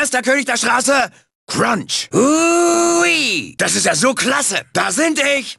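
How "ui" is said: long, high held whoop; gleeful whoop. Acted. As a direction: whoop with delight at the speed. Joyful whoop while driving.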